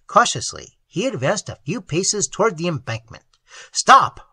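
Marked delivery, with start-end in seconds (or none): 3.7-4.3 loud sharp command; sentry ordering Enriquez to stop, shouted. A narrator's reading. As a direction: yell it to halt him. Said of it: "ordered the sentry".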